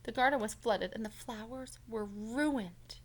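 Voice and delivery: usual speaking voice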